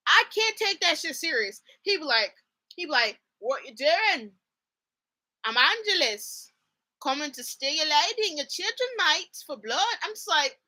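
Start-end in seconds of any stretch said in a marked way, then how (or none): 3.4-10.0 drawn-out, hammed-up Irish vampire; bad Irish accent. Mocking Angel's Irish lines to listeners, bad Irish accent. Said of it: "yeah, the angel voice".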